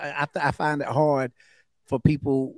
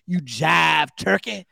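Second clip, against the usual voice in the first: slow, loud seventies jive voice; seventies jive talk; 7 dB louder, slower than usual. Shown as a demonstration. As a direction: drawl it out like a seventies dude.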